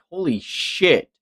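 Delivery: ordinary delivery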